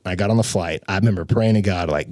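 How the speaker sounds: ordinary delivery, nothing marked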